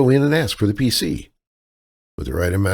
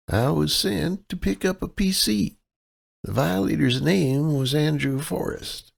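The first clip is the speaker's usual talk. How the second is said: higher, helpless Midwestern damsel voice; damsel in distress; 9 semitones higher, 4 dB quieter than usual. Acted. Kaylee sweet-talks the clerk as a damsel in distress. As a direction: play the sweet, lost out-of-towner, pitched up. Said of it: "her damsel in distress persona".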